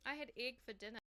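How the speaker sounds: normal talking voice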